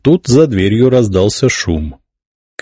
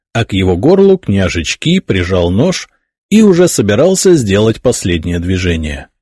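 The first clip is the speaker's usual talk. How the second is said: ordinary talk, nothing marked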